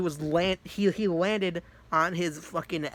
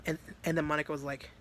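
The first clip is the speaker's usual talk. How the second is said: usual speaking voice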